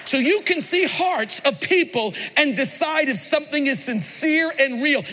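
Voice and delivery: ordinary talk, nothing marked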